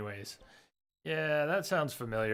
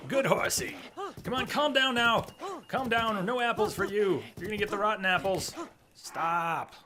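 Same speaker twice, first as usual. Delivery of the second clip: coaxing horse; 7 semitones higher than usual. Real, not acted. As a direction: coax and scold the horse at once. Still soothing the horse.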